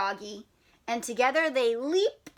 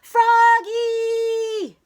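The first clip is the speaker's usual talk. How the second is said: high, loud stretched yell; coach's yell; 10 semitones higher, 8 dB louder than usual. Acted. Coach yelling for Froggy, loud and high. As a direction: bellow his name across the field. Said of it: "yelled the coach".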